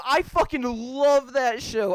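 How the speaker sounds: loud, slow, emphatic love; passionate. Real, not acted. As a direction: declare it with passion.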